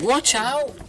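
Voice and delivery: high warning cry of watch out; warning shout. Shown as a demonstration. Reader jokes about skeleton armbands, high warning shout. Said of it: "Get it?".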